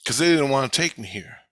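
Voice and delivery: plain reading voice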